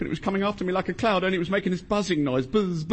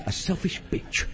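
softer, slow, discreet repeat; hushed insult; 6 dB quieter, slower than usual. Acted. Shushed husband repeating the insult to his wife, hushed. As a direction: keep the insult down for the room.

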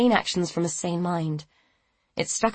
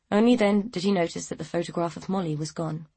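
plain reading voice

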